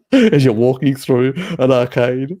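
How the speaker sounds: high, loud words through a laugh; laughing while you say it. Real, not acted. Laughing while describing targeted ads.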